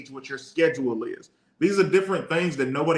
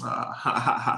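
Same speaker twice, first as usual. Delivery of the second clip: awkward laugh; 3 semitones lower than usual. Retold or imitated. Awkward laugh after being called out.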